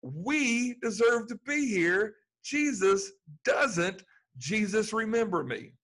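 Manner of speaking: slow and lifted, pleading; thief's plea. Retold or imitated. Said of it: "Listen to what the guy said".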